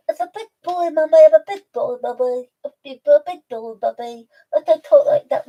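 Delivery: silly stuffed-nose animal character; talking-pet voice. Acted. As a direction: play a pet answering back to Mummy. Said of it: "when we talk to Alfie".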